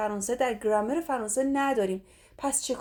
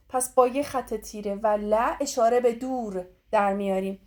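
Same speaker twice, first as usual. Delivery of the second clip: usual speaking voice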